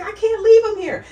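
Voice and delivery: high, loud desperate cry; a retold mother's anguished plea; tearful plea. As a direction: beg through tears not to leave them. Weeping mother pleads with doctors.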